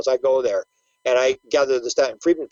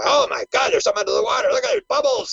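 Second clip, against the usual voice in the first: high-pitched excited pilot exclamation; mock astonishment; 12 semitones higher, faster than usual. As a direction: imitate pilots amazed by what they spot. Acts out pilots spotting bubbles.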